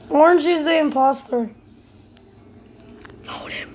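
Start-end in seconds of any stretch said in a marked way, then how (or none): 3.2-3.7 soft urgent whisper. Real, not acted. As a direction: whisper the order. Urging vote quietly.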